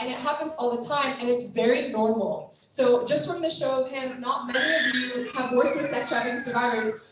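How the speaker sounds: ordinary delivery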